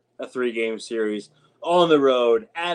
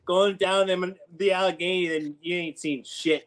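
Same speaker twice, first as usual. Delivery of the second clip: higher hammed-up yinzer voice; mock Pittsburgh yinzer; 4 semitones higher than usual. Cohost joining the bit, mock yinzer accent. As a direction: boast like a Pittsburgh local.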